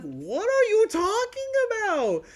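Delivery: slow, high, loud disbelief; hammed-up retold outrage at a text; incredulous. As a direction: stretch it out in shocked disbelief. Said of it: "I'm like".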